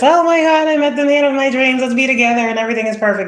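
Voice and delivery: high, fast swooning lovestruck voice; mock dreamy gush. Mocking naive twin-flame believers, high giddy gush. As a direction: gush like a starry-eyed romantic. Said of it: "They're not just".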